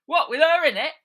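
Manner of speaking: high, loud shocked question; high, loud disbelief; shocked henchman. Acted. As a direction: gasp it at the boss.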